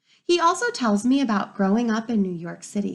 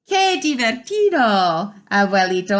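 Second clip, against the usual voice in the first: put-on delighted child; 8 dB louder than usual. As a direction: cry out with delight to grandpa. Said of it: "I say".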